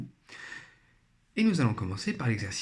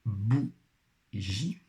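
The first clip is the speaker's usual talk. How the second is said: "bougie" split into bou, long pause, gie; syllable by syllable. Shown as a demonstration. Teacher helping pupils find the syllable, syllable by syllable. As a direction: sound out "bougie" slowly in two beats. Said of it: "première syllabe".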